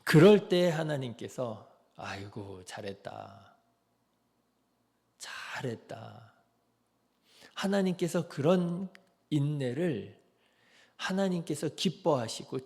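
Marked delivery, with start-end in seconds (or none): 2.0-6.3 much softer, fading into a slow whisper; God whispering well done, tenderly; tender whispered praise. Pretend. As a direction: praise like a loving father, barely breathed. God praising the patient believer.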